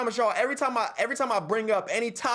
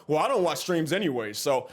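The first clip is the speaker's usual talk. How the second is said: mocking commenter; 6 semitones lower than usual. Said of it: "people in the comments talking about".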